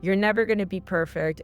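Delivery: ordinary delivery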